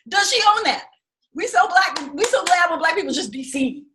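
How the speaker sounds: a hint of a preaching dad; imitating father. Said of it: "my father's complete response".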